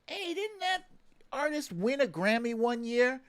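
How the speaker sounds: high, eager retold thought; recalling a lightbulb moment, pitched high; sudden realisation. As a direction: pop up with the idea mid-sentence. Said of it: "And I was like".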